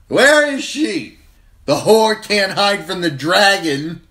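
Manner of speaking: wine-soaked prince raging at the feast; drunken ranting. A narrator's reading. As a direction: rant like an outraged drunk king. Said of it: "his voice thick with wine".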